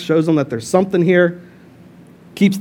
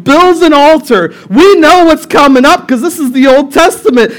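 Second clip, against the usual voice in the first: usual speaking voice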